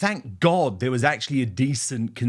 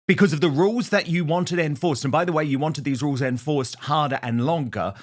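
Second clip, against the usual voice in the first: normal talking voice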